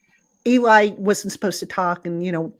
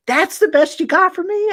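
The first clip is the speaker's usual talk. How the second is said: high-pitched mock disbelief; teasing, busting on him; 10 semitones higher than usual. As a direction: needle him as if let down by his reaction. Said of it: "I was busting on him".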